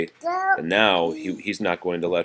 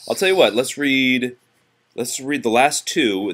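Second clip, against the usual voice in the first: higher, louder upbeat offer; cheerful redirect; 6 semitones higher, 5 dB louder than usual. Real, not acted. Parent cheerfully steering child back to reading.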